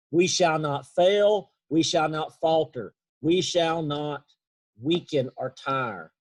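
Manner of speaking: slow, measured declamation; slow oratorical quote. Retold or imitated. Speaker quotes Churchill urging his people on, slow and deliberate. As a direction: space out each phrase like a speech, slowly. Quoting Churchill's rallying wartime speech.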